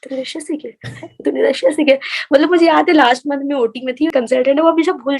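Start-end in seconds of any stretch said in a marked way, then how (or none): 0.0-2.0 imitated disbelief. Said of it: "सब लोग मेरे से दो बार पूछते हैं".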